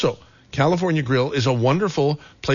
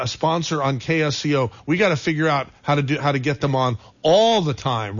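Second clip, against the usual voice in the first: normal talking voice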